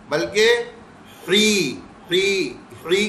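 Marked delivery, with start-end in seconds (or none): 1.3-3.1 "ہری" as a throaty "hee", slowly drawn out; slow, separate "hee" for "ہری"; correct "hee". Shown as a demonstration. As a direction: model the syllable "ہری" with a clear long "ee". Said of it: "ہری کی آواز نکالیں گے".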